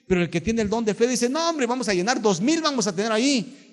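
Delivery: high, bold promise of two thousand; confident excitement. Retold or imitated. Man of faith telling the congregation, bold and excited. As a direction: sound sure and thrilled.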